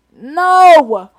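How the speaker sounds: high, loud, drawn-out shout; outraged refusal. Real, not acted. Rejecting the idea of confessing an embarrassment, outraged shout. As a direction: cry out in comic outrage.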